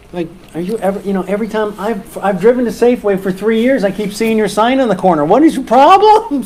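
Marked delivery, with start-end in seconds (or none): 5.3-6.4 mock exasperation. Irritated driver passing the sign.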